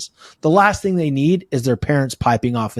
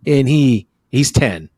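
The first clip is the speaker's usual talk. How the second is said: ordinary delivery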